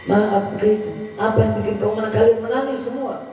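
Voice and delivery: lightly voiced bewildered traveller; a touch of puzzled traveller. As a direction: ask the weeping crowd why.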